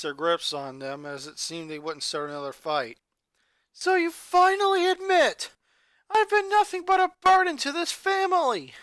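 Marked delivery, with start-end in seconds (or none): 3.7-8.7 high, loud bitter outburst; angry shout. Acted. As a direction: throw the accusation back hard. Boy shouts back at sister.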